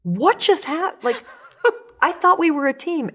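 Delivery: baffled and half-laughing after the crash; stunned disbelief. Pretend. You after the crash asking inspiration, laughing disbelief. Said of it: "you're gonna be like".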